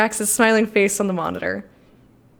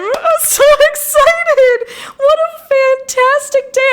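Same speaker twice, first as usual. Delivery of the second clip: gushing, squealing much higher and louder; bursting with delight for a viewer; overjoyed; 11 semitones higher, 4 dB louder, slower than usual. Real, not acted. Streamer gushing proudly to a viewer who came out, squealing much higher. Streamer reacts to chat coming out.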